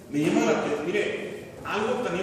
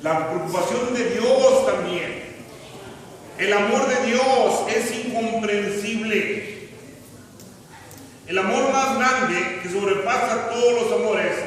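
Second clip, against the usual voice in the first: raised, emphatic sermon voice; fervent preaching; 7 semitones higher, 7 dB louder than usual. Acted. Preacher proclaiming God's love to the congregation, fervent preaching.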